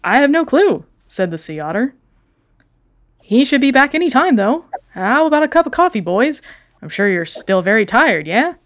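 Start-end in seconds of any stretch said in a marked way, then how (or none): 0.0-0.8 high, louder eager otter voice; chirpy nervous shopkeeper; sea otter voice. Acted. Otter answers. 3.2-8.5 high, louder eager otter voice; chirpy nervous shopkeeper; sea otter voice. Acted. Otter offers coffee.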